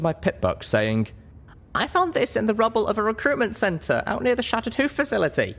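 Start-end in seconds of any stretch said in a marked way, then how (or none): 1.7-5.5 put-on much higher, lighter young mare voice; a high young pony heroine choosing her words; heroine's voice. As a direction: speak up as the small, earnest heroine. Heroine shows her pipbuck.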